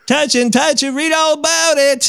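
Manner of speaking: high, far English newsboy cry; English paperboy accent. Retold or imitated. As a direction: hawk headlines like a London paperboy. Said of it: "it's like".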